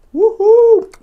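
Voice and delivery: high shrill whoop. Acted. Tribe cheering as the meat goes on the grill. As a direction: cheer like at a party.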